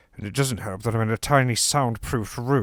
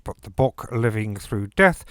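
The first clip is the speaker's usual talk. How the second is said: usual speaking voice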